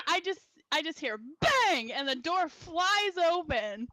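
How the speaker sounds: normal talking voice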